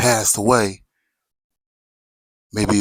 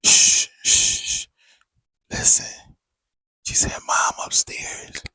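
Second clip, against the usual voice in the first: whispered shush; slower than usual. Retold or imitated. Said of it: "my grandma was like".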